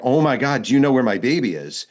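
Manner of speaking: retold screaming and crying. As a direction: retell her outburst, without full screaming. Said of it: "She's screaming and crying".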